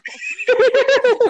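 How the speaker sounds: loud high burst of laughter. Real, not acted. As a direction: let out a big high laugh.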